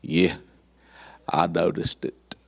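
low, lazy East Texas drawl imitated; a deep southern East Texas drawl, lightly imitated. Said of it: "That's that southern East Texas drawl".

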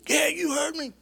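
put-on high, sneering punk kid voice, lightly done; bratty teen taunting a stranger; mock punk kid. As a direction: mimic a smart-aleck kid taunting. Mocking punk kid's reply.

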